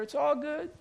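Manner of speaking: sing-song the word all, high and lifting; an airy, mocking sing-song. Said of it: "He'd say".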